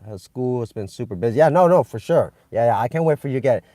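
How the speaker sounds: usual speaking voice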